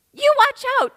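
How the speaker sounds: put-on high childlike warning; a toddler warning the older boys; little girl shouting. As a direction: shout the threat like a tiny hero. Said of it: "she shouts".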